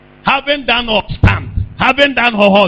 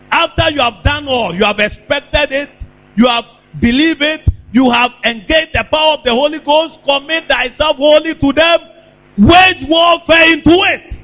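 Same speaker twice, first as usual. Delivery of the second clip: impassioned preaching; 7 semitones higher than usual. Real, not acted. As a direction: build the exhortation step by step. Preacher builds an exhortation.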